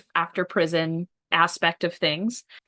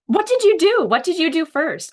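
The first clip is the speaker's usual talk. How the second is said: eager question; 8 semitones higher, 6 dB louder than usual. Real, not acted. Host eagerly asks about first day.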